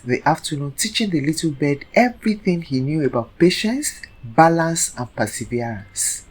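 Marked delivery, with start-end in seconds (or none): none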